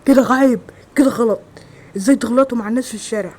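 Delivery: shouted reprimand, easing toward the end; retold yelling. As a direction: act out telling the kids off, loudly at first. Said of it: "زعقت لهم وقلت لهم".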